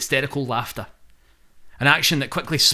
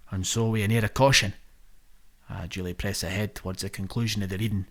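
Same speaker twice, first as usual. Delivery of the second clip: usual speaking voice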